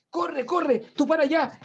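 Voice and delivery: high, urgent calls to run; panicked shouting. Acted. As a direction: act out frantic people fleeing the walls. Acting anxious characters running.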